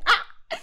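short, high, loud "ah!"; mock yelp of alarm; startled cry. Acts out her startled reaction.

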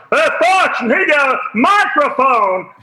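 high, loud mock shout of a rally crowd; hammed-up crowd shout; crowd yell. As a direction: yell it like an excited heckler. Crowd pointing and yelling.

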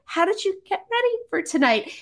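high-pitched question at normal volume; nervous repeat. Retold or imitated. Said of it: "I was so nervous".

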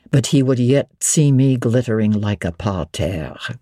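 low, amused suitor. A narrator's reading. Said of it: "he said".

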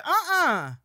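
high, loud disapproving uh-uh; sassy refusal. Retold or imitated. Friend on the phone objecting, loud high uh-uh. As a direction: shut it down with a sharp uh-uh. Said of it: "she immediately is like".

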